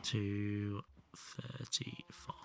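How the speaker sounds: slow whispered number; whispering. Real, not acted. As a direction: murmur it to yourself slowly. Whispered while calculating.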